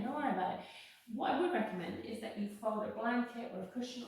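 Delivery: usual speaking voice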